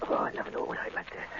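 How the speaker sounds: breathless whispered moan; whispered agony on the operating table; pained whisper. Acted. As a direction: groan it out under the knife. Patient whimpers during operation.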